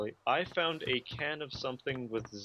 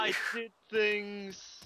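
mock indignant; 8 semitones higher, much slower than usual. Defending his contribution.

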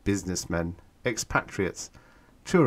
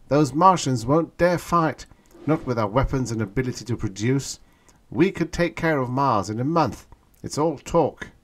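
higher, louder boastful voice; cocky young man; 6 semitones higher, 5 dB louder than usual. A narrator's reading. Young man boasting to the girl, cocky. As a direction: brush off the danger confidently.

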